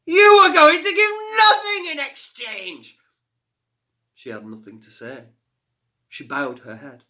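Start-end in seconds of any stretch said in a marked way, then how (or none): 0.1-3.0 mocking fire fiend. A narrator's reading. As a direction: sneer down at the tiny girl.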